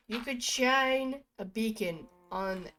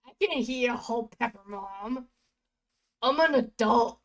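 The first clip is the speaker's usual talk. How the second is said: put-on macho kid; 2 semitones higher, 8 dB louder than usual. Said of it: "I was trying to be macho".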